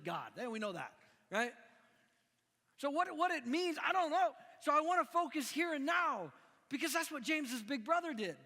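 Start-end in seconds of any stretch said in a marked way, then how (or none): 2.8-8.4 preaching emphasis. Real, not acted.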